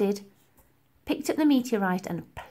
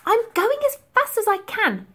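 put-on much higher, sharp girl's retort; snappy young girl; 13 semitones higher, 5 dB louder than usual. A narrator's reading. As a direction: snap back like an annoyed girl. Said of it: "snapped back the girl".

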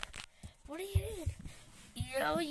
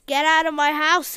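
much louder, firm order; shouting order; 13 dB louder than usual. Acted. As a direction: throw him out. Repeats louder.

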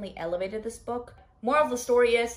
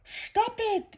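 high, pleading squeal; high-pitched protest; 12 semitones higher than usual. Real, not acted. Reader objecting to the book, high-pitched protest.

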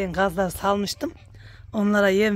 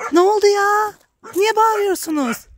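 much higher, sing-song voice for the geese; talking to animals; 11 semitones higher than usual. Real, not acted. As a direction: coo at the noisy geese. Calling to her noisy geese. (kazlara ince, cilveli sesle seslenme)

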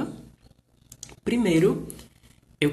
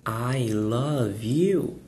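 "I love you" bem devagar e claro; frase exemplo lenta; 2 semitones lower, much slower than usual. Shown as a demonstration. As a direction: pronuncie "I love you" palavra por palavra, devagar. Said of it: "deixar algo categórico como". (professor dita "I love you" para os alunos, bem devagar)

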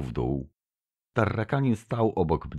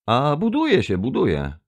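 a sudden, louder blurted answer; 11 semitones higher, 5 dB louder than usual. A narrator's reading. Envoy improvising an answer to his alien sponsor, blurted out. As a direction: blurt it out, pitched up at the start. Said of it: "wypaliłem".

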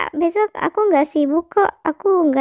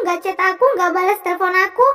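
angry complaint; 2 semitones higher, faster than usual. Acted. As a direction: rattle it off at him, annoyed. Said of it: "aku marah, aku kesel". (mengomel dengan kesal)

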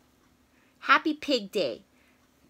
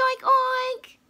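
pig oinks; 13 semitones higher, 6 dB louder than usual. Acted.

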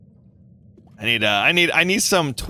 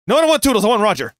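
higher, louder correction; emphatic; 7 semitones higher, 5 dB louder, much faster than usual. Real, not acted. Streamer correcting a character choice, loud and high. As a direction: insist on the right pick.

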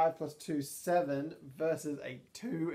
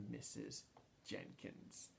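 hushed reveal; 2 semitones lower, 16 dB quieter, slower than usual. Acted. Dramatic reveal of car.

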